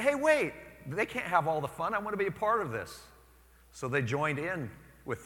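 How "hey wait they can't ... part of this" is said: high, loud, keen; eager onlookers. Retold or imitated. Early Christians watching converts, high and eager. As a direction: want in on the fun. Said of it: "And they're saying".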